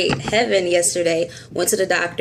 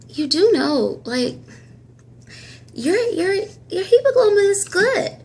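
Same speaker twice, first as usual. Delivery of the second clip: imitating the doctor; 12 semitones higher than usual. Doctor's voice in retold scene.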